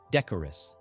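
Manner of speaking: ordinary talk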